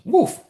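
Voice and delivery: high yelped woof; woof exclamation. Real, not acted. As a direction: bark out a sharp woof. Reacts to heavy stuff.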